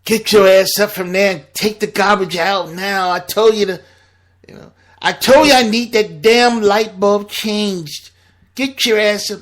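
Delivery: imitating a nagging wife, loud and high; nagging yell. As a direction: bark orders like a fed-up wife. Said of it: "getting nagged".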